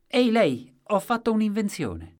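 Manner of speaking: loud, high hail to a stranger; over-eager inventor calling for attention; excited blurting out. A narrator's reading. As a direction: blurt it out at full pitch. Inventor blurts news at a passenger.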